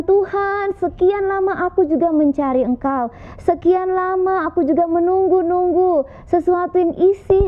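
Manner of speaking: put-on high, heartfelt prayer of a young man; young man praying. Young man finally finds God and prays, high and heartfelt. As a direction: pour it out high, as a first prayer.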